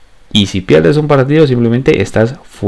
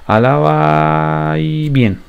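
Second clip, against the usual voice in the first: ordinary delivery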